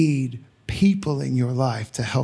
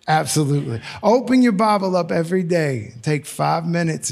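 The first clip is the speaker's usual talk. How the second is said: ordinary talk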